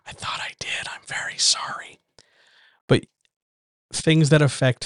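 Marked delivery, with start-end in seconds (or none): 0.1-2.0 hushed, whispered apology; whispered aside. Real, not acted. Recorder apologizing for the robot left plugged in, whispered. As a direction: drop to a sheepish whisper.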